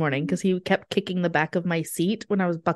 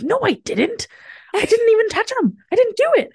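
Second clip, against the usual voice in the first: much higher, louder defensive whine; imitating husband's denial; 4 dB louder than usual. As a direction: replay the husband protesting innocence, pitched up and louder. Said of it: "He's like".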